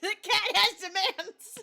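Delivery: laughing mock announcement. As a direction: retell it loudly, cracking up. Retelling cat's tantrum while laughing.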